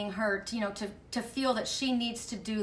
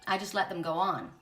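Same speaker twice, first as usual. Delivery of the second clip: usual speaking voice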